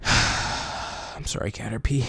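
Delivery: playacted grief; mock mourning. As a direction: apologise to a lost pet, hushed. Mock mourning his Caterpie.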